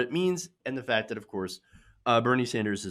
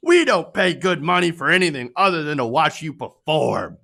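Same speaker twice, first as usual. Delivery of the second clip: mock entitled fan; 7 dB louder than usual. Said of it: "this one says".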